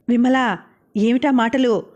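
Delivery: plain reading voice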